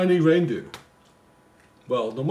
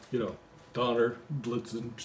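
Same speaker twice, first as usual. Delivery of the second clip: usual speaking voice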